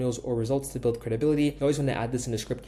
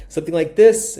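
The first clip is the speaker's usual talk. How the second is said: jumps much higher and much louder on this; lively varied voice; 5 semitones higher, 12 dB louder than usual. Shown as a demonstration. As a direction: show off a big swing in the voice. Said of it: "Emphasizing words and then going back down".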